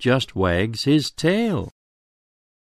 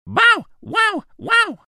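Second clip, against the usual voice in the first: very high yappy puppy barks. A narrator's reading. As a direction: bark like a little pup. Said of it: "The pup speaks up".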